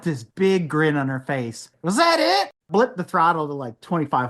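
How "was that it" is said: high-pitched imitated taunt; mock challenge. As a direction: goad him in a high voice. Retold woman goading him after engine start.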